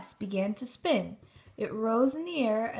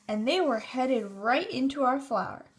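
ordinary delivery, nothing marked

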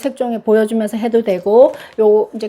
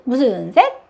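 ordinary delivery

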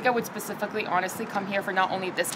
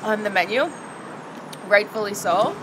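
puffing from spicy heat; talking while chewing. Real, not acted. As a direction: mouth full of food. Seen 1: Closed, pursed mouth chewing just-eaten noodles; puffs air out from spice.

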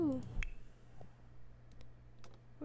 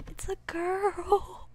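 louder, much higher, slow delighted reveal; excited announcement; 7 semitones higher, 7 dB louder, much slower than usual. Real, not acted. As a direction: announce it with joy. Baby revealed in game.

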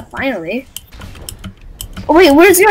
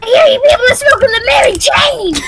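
bad Toad impression. Gamer keeps doing a bad Toad impression, shouting the line. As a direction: yell it like a cartoon mushroom. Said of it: "toad on drugs".

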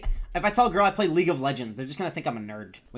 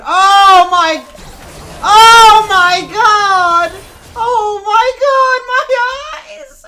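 very high, slow, stretched-out scream; shrieking cry; 15 dB louder, much slower than usual. Real, not acted. Streamer shrieks at the screen, a drawn-out cry. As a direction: wail it like your eyes are burning.

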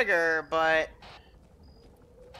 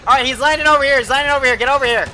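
loud, high, urgent shout; shouted callout; 6 semitones higher, 8 dB louder, faster than usual. Real, not acted. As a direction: yell it to your teammate. Urgent callout as chopper lands.

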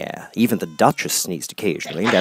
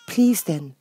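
Alice; 9 semitones higher than usual. Acted. Alice character voice.

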